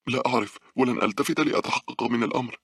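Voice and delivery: high, pressed voice of a runner; urgent running reply. A narrator's reading. Girl sprinting for the train answers her friend, urgent. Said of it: "ردت مونيكا وهي تعدو بأقصى سرعة".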